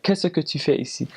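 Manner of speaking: rough attempt at Swiss French. Shown as a demonstration. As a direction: have a go at a Swiss French accent. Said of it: "That's more like Swiss".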